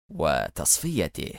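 slow, low, soft menace; fierce smile. A narrator's reading. Boss ordering the target's elimination, slow and menacing. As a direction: draw the word out with quiet ferocity. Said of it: "وابتسم في شراسة قبل أن يستطرد".

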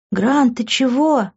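ordinary delivery

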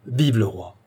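ordinary delivery

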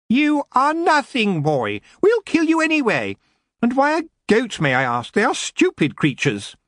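high, screechy elf contempt; scornful elf lord. Acted. Elf lord belittles the boy, scornful screech. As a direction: sneer from high up in the voice.

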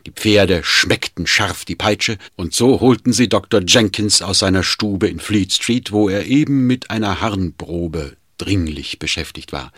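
ordinary delivery, nothing marked

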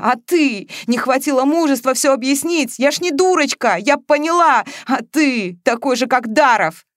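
high, loud counter-attack; heated, hurt indignation; sharp accusation. A narrator's reading. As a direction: hurl the blame back at them. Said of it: "резко отвечала Маша".

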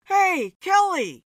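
high, loud hail; calling out. A narrator's reading. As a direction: call to her across the bar, high and loud. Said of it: "the guy called out to her".

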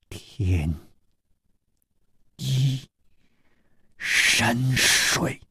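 drawn-out syllables with long pauses; word by word. A narrator's reading. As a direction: let each syllable hang, softly. Woman names the stolen water slowly.

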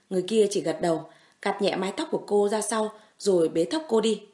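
ordinary delivery, nothing marked

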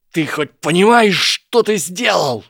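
high, loud furious outburst; choking with fury. A narrator's reading. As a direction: spit it out breathless with rage. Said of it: "Драко задохнулся от ярости".

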